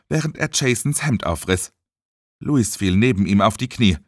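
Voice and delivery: ordinary delivery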